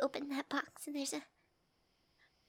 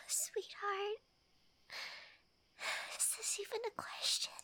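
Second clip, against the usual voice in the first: tearful squealing yes; 5 semitones higher, 7 dB louder than usual. Acted. Accepting the proposal.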